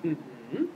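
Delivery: soft low mm-hmm of agreement; a quiet nod of a hum; agreeing noise. Shown as a demonstration. Listener softly signals they are following, low agreeing hum. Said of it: "make agreeing noises like".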